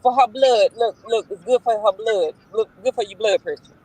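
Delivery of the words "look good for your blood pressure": ordinary talk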